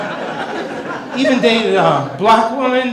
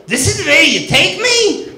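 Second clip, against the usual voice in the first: a shrill-free but much higher comic Yiddish matron; 9 semitones higher than usual. Retold or imitated. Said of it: "Can you imagine it?".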